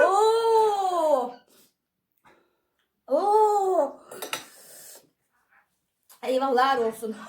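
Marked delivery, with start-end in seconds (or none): 0.1-4.0 pained burning cry. Real, not acted. Burning mouth from spicy food.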